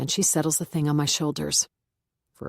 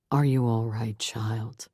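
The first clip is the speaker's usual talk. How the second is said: lower, measured pastor voice; the Reverend; 6 semitones lower, 3 dB quieter, slower than usual. A narrator's reading. Reverend to new hire Beth, lower pastor voice. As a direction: speak as a calm church reverend.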